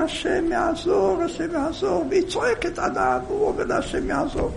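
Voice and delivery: serene, unbothered reassurance; pious calm. Acted. Husband calmly answering his crying wife.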